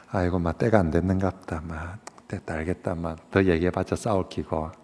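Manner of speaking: softly conceding; resigned giving up. Retold or imitated. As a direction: sigh and let the argument drop. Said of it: "아마 제 같았으면 그랬을 것 같아요".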